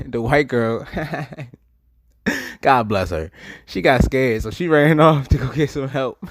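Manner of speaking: high, loud words breaking into laughter; laughing while talking. Real, not acted. Storyteller recalling his girl running for help, cracking up. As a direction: crack up mid-sentence, really laughing.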